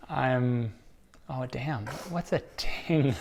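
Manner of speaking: ordinary talk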